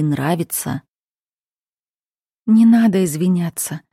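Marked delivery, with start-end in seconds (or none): none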